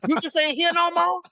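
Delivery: loud, very high-pitched re-enacted yell. Grieving man re-enacting his outburst to the departed, yelling out. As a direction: cry out in high, loud disbelief, acting it out. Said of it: "sometimes I just yell out".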